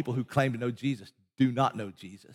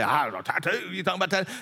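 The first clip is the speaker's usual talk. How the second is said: doubting churchgoer; faster than usual. Retold or imitated. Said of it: "Some of you would say".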